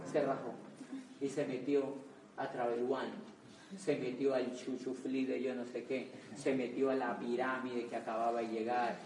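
deflated low aside. Real, not acted. Deflated telling of quitters.

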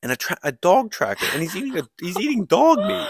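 high, loud incredulous build-up; excited disbelief. Real, not acted. Teller worked up over absurd twist.

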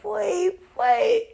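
high, loud laughing squeal. Real, not acted. Reacting to her friend's mugshot, laughing hard. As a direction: break up laughing mid-line.

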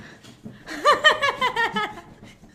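high whimpering dog yelps; high yelping dog imitation. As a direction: yip like a dreaming dog. Imitating dreaming dog noise.

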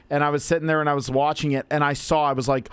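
ordinary talk

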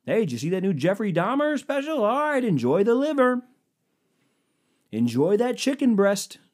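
high, hammed-up chatty guest; bright and oblivious, asking about a killer; mock cheery guest. To wedding guests, a high hammed-up cheery chat.

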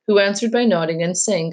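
ordinary delivery

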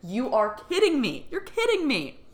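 high-pitched, incredulous, with a laugh; retold can't-believe-it exclamation; amused disbelief. Acts out disbelief at the message.

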